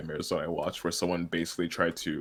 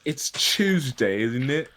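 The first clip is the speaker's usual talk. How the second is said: hammed-up British accent, slow and higher; mock British accent; 7 semitones higher, 7 dB louder, much slower than usual. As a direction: overdo a British accent, drawn out. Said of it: "British people be like".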